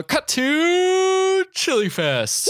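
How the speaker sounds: high, slow drawn-out announcement; announcer voice. Real, not acted. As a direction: announce it like a show host. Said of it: "announcing scene change".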